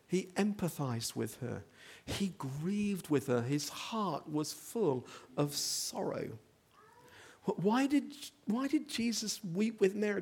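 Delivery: normal talking voice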